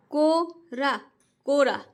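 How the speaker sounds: "को" and "रा" split apart, then "कोरा" joined slowly; syllable-by-syllable reading. Shown as a demonstration. Teacher shows parents how children should read, split then blended. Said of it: "exactly वैसे ही पढ़ाना है".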